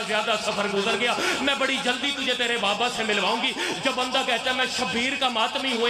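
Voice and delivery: high, building mourning recitation; climactic majlis lament. Acted. Reciter driving the mourners toward the climax, high and keening.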